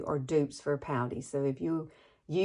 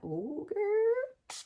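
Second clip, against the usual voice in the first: delighted squeal. Real, not acted. Delighted at her dewy skin.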